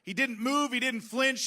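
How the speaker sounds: ordinary delivery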